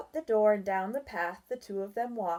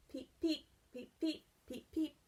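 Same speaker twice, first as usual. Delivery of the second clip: high soft peeping; frog peeps; 6 semitones higher, 7 dB quieter than usual. Acted. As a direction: chirp like a tiny spring frog. Frog sound effect in story.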